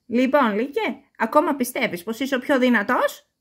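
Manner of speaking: mother goat calls out sternly; mother goat shouting. A narrator's reading. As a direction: shout down at the tied wolf. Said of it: "φώναξε η μαμά Κατσίκα".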